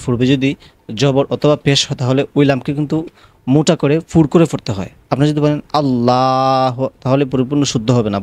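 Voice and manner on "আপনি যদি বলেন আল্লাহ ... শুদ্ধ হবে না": usual speaking voice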